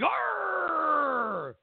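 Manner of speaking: high growl of sudden anger; hammed-up little angry grunt; mock angry growl. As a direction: growl like she's mad. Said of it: "like, get angry".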